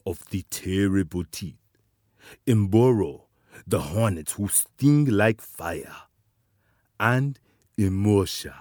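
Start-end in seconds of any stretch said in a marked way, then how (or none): none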